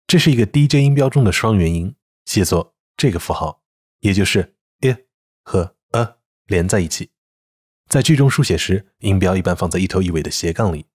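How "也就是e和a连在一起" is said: usual speaking voice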